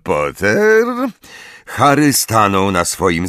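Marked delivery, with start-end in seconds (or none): 0.0-0.8 slow, high, stretched-out order; menacing slow instruction; drawled command. Acted. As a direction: draw out the order like a sneering teacher. Snape orders his pupil, drawling.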